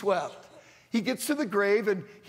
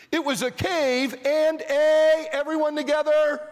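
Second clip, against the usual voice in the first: prompting the congregation; 7 semitones higher, 5 dB louder than usual. Real, not acted. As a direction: stretch it out and leave the last word open. Cueing congregation to supply word.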